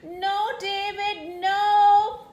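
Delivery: high, loud, drawn-out mock scream; screaming mama. A narrator's reading. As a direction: call after the runaway boy, slow and building. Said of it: "screams Mama".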